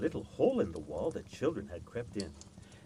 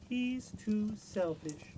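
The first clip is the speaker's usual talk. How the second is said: high, slow, disapproving autumn voice; high, slow reproachful female voice; autumn's reproach; 9 semitones higher, much slower than usual. A narrator's reading. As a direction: tut it out slowly like a sorrowful season. Autumn speaks.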